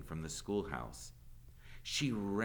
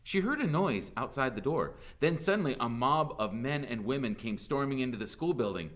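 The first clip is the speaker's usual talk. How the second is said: usual speaking voice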